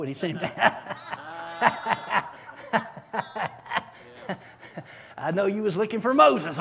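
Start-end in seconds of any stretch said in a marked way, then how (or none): 0.5-3.8 breathy drawn-out laughter; long laugh. Real, not acted. Preacher laughing at his Moses joke with the congregation. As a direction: laugh at your own joke.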